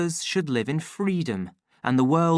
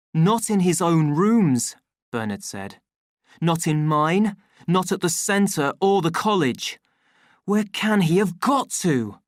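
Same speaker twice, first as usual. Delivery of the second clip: worried Bernard; 6 semitones higher, 6 dB louder than usual. A narrator's reading.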